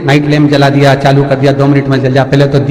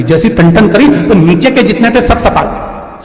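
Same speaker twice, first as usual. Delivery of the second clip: ordinary talk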